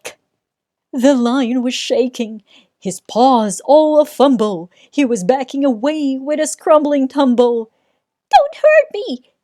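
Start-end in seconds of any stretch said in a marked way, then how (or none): 0.0-0.2 mock eek. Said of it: "let out an eek". 8.4-9.3 much higher and louder, a scared plea; big lion begging like a frightened kitten; whimpering lion. Acted. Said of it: "he whimpered".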